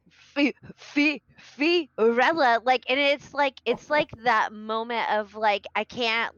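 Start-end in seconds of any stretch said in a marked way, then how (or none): 0.1-2.6 high, stammering panic; panicked stammer. Retold or imitated. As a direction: stumble over the name in fear. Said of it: "she's like".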